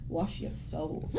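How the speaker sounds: slow, low and soft, almost breathed; solemn whisper-quiet voice. Retold or imitated. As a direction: intone it like a hushed meme character. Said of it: "and I just think".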